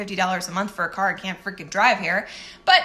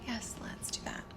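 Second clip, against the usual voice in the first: whisper; 17 dB quieter than usual. Real, not acted. Answering her own question to herself.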